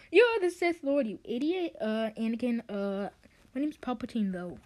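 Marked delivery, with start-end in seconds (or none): 0.1-1.7 high, loud indignant accusation; hammed-up outburst at a villain; mock outrage. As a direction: accuse in a raised, scandalised voice. Acted accusation in Star Wars parody.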